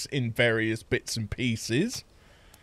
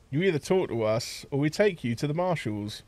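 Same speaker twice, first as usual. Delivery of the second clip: normal talking voice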